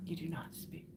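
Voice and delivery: unvoiced whisper of "You do not speak". Rereading the poem line to herself, in a whisper. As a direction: breathe it out spookily.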